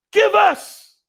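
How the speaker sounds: high, loud barked order; explosive demand from a retold robber; robber's shouted demand. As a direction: shout it as a sudden threat.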